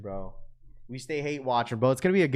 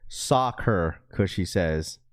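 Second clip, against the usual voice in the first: normal talking voice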